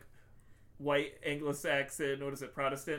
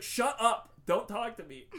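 raised, louder retold snap; mock angry; 7 semitones higher, 5 dB louder than usual. Host voicing Bobby shutting down his rival, mock angry. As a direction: cut him off in mock anger. Acted quarrel, Bobby snaps.

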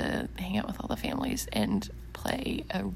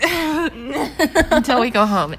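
laughing; 6 semitones higher, 9 dB louder, much slower than usual. Real, not acted. Jokey reply laughing.

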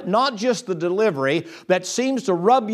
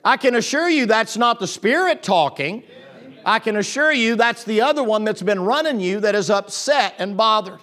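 ordinary talk, nothing marked

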